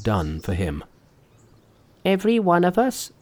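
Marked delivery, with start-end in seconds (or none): 2.0-3.1 high-pitched, earnest character voice; Monkey. A narrator's reading. Monkey answers his master's thanks, high earnest voice. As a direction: open the speech in a raised voice. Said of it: "said Monkey".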